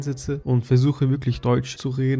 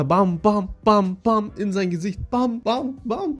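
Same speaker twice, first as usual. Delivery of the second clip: bam sounds climbing much higher; rising punch sounds; 7 semitones higher, slower than usual. Retold or imitated. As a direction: let each bam climb higher as he loses control. Punching faster and harder in the dream.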